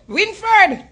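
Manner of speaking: pleading falsetto mock of a wounded lover; mock Aisha. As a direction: squeak it out like a lovesick woman moaning to her man. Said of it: "You ever hear Aisha out about".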